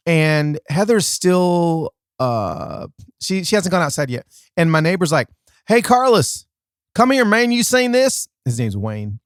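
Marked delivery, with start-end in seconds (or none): 5.7-8.3 higher-pitched imitation of a neighbor hailing someone; neighbor calling over. Neighbor calls storyteller to see the damage, imitated call. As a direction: act out a neighbor's high-pitched summons.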